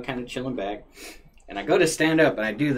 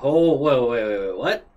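startled protest; 3 semitones higher, 8 dB louder than usual. Retold or imitated. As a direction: caught off guard. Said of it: "And I was like".

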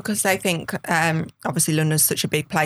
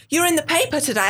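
retold excitement; 9 semitones higher than usual. Retold boyfriend announcing news.

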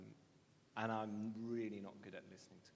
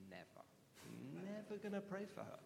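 defiant refusal; 9 semitones higher, 5 dB quieter than usual. Retold or imitated. Refusing God's prompt, inner voice.

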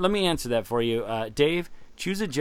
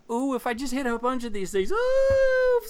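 excited squeal; 11 semitones higher than usual. Acted. Squeezing the toy porg.

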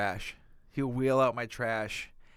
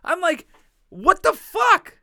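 high, loud comic outrage; retold outrage, voice shooting way up; exasperated outburst; 8 dB louder than usual. Man retelling being woken by church plows, indignant yell.

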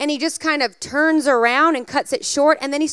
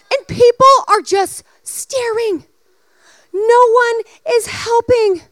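excited; 9 semitones higher, 5 dB louder than usual. Acted. Climax of the story told.

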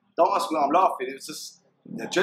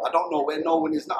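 ordinary delivery

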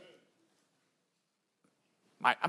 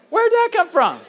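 high-pitched mock bewilderment; baffled; 11 semitones higher than usual. Joking about catching himself using an accent, baffled. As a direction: act startled by your own words. Acts shock at own new accent.

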